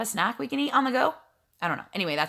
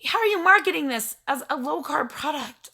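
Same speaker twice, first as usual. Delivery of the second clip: higher-pitched indignant complaint; mad; 6 semitones higher than usual. Retold or imitated. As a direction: act out exasperated outrage at being misled. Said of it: "I was mad".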